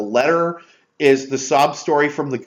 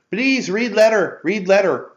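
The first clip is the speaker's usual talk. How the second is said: Benito; 6 semitones higher than usual. Retold or imitated. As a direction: imitate a cute little thief pleading. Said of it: "I hate doing that voice".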